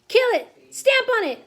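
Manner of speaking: high, loud, violent orders; shouting. A narrator's reading. Cooks hunting a mouse underfoot, shouting. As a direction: shout like a mob on the chase. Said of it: "they were shouting".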